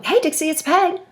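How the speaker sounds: bright high sing-song hello, settling in for a long chat; chatty friendly greeting. Retold or imitated. Peg calling Dixie for a chat, high chatty greeting. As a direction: greet her like an old friend with time to talk. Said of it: "this is how the conversation tends to go".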